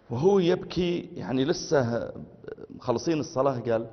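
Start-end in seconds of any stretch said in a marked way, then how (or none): none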